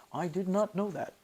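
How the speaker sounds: a quick, bad impression, half under the breath; badly done mimicry, muted; terrible impersonation. Shown as a demonstration. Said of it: "that's a terrible impersonation".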